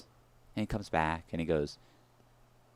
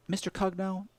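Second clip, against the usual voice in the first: a hint of a polite, raised teller voice; lightly voiced teller calling his name; bank teller; 9 semitones higher, 8 dB quieter than usual. Bank guy calls him by name, lightly voiced. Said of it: "he goes".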